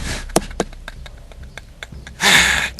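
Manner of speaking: breathy burst of laughter; amused laugh. Real, not acted. Storyteller amused by a friend teaching his dog, laughing. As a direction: let a laugh escape.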